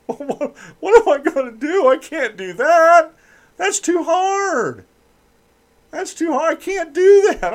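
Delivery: high whiny falsetto mocking a lazy student. Radio host mocks college student in high whiny falsetto. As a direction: sneer at him in a high squeaky whine.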